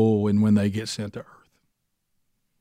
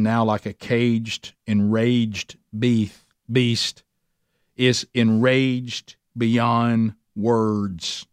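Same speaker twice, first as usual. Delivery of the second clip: slow, weighty drawl; much slower than usual. Acted. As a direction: drag each word out, very slow. Drawn-out picture of caged Satan.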